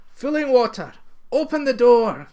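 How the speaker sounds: high, loud pleading call; imploring. Acted. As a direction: beg the water urgently to open up. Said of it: "they implored".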